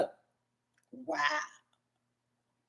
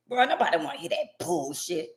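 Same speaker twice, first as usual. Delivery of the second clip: ordinary delivery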